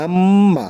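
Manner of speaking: আম্মা with the mm held long through the nose; long nasal hum on আম্মা; nasal ghunnah. Shown as a demonstration. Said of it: "মিম জবর মা".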